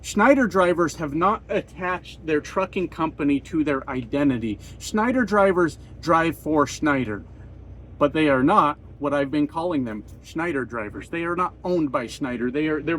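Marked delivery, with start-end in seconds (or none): none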